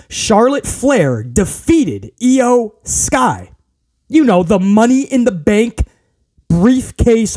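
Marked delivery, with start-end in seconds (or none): 0.1-3.5 each word spaced out slowly; slow, emphatic repeat. Real, not acted. Telling those who missed the show, slow and emphatic. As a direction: spell it out word by word, incredulously. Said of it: "you have to listen to this".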